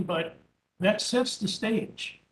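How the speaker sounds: ordinary delivery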